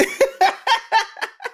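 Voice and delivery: a real, high-pitched burst of laughter at her own pun. Real, not acted. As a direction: laugh out, amused at yourself.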